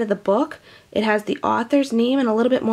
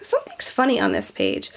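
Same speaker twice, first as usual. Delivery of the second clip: ordinary talk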